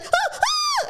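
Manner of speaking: high mock shriek of pain; mock scream. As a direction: shriek like the bitten woman, hammed-up. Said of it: "and she's like".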